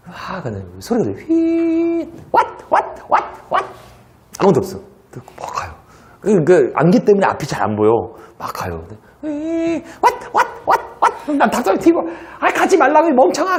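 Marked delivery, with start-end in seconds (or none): 1.3-3.6 high eerie whooshing wail; eerie wind sound. Retold or imitated. Teacher making spooky fog noises for students. 9.2-11.2 high eerie whooshing wail; eerie wind sound. Retold or imitated. Teacher making spooky fog noises for students. 12.4-13.6 high, laughing mock shout; mock yell at the heroine. Teacher yelling at a film heroine, laughing.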